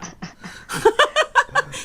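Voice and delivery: squeaky high cat meow; kitten voice. Shown as a demonstration. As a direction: do a tiny kitten mew. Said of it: "This is my cat impression".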